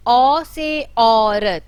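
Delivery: औरत said very slowly, औ drawn out loud; long au vowel demo. Shown as a demonstration. Teacher to pupils, lengthening the औ. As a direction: stretch the औ in औरत. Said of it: "उच्चारण थोड़ा सा दीर्घ हो जाता है".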